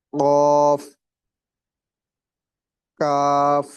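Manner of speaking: each letter called out loud and slow; letter names recited. Shown as a demonstration. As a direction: announce every letter firmly with a pause after. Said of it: "وہ ابھی آپ کو بتاتا ہوں". (ہر حرف اونچی آواز میں الگ الگ)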